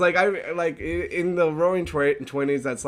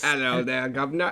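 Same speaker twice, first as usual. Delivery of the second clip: put-on Cockney accent. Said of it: "the Cockney accent".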